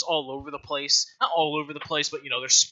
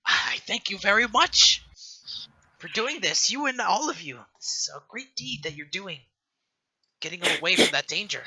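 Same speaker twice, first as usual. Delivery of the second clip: put-on higher, louder old woman's voice; grateful old matron; 9 semitones higher, 6 dB louder than usual. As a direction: thank the heroes like a proud old caretaker. Matron greets the party.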